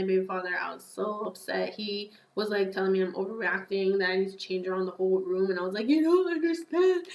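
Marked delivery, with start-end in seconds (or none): 5.9-7.1 high, louder-than-usual tearful protest, replayed for the story; mock bawling. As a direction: imitate yourself wailing through tears. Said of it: "And I started bawling".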